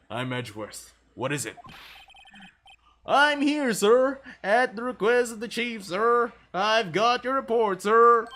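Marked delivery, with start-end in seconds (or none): 3.1-8.3 higher, louder, eager rookie cop; a nervous beat cop bursting in to report; goofy cop voice. Acted. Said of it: "Let me try and find a voice for him".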